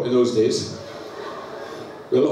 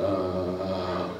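low, soft blissful sigh; 9 semitones lower, 9 dB quieter than usual. Retold or imitated. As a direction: let out a quiet contented "ah". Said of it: "it was sort of like".

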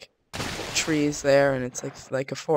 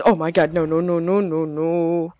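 loud frantic string of no; panicked no; 8 dB louder than usual. Real, not acted. Gamer spotting an enemy nearby, panicked loud protest. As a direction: shout it in sudden panic.

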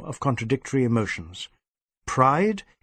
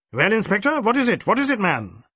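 impatient DSP; 8 semitones higher than usual. Acted. Superintendent hurrying his inspector, impatient and brisk.

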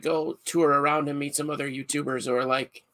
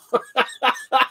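laugh; 10 semitones higher, 3 dB louder than usual. Real, not acted. Laughing at own accent.

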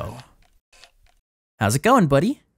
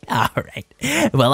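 normal talking voice

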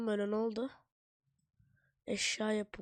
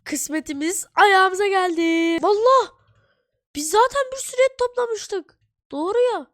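high, loud, gleeful cry; excited shouting; 10 semitones higher, 13 dB louder than usual. Real, not acted. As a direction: cheer it out in delight. Food falls into their lap.

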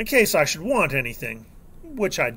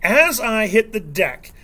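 plain reading voice

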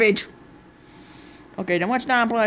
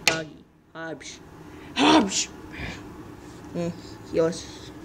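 ordinary talk, nothing marked

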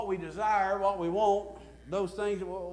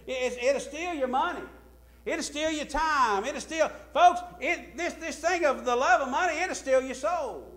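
louder, higher warning from the pulpit; impassioned preaching; 9 semitones higher, 8 dB louder than usual. Acted. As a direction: hammer home the danger. Preacher warning about love of money.

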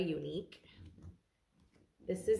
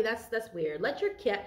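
normal talking voice

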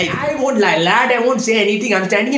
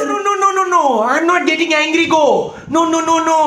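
much higher, pleading no, no, no; placating no no; 6 semitones higher, much faster than usual. Acted. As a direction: rush to calm her down, pitched up. Calming an angry friend.

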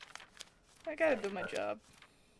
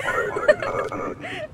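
loud, high nervous laughter; panicky laugh; 8 dB louder than usual. Real, not acted. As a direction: crack up under pressure.

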